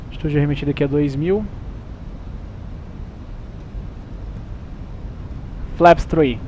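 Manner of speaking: pilot cockpit headset. Real, not acted. Pilot radio calls in cockpit.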